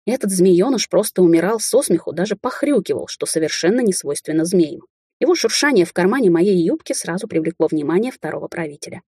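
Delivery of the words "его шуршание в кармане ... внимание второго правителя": ordinary delivery, nothing marked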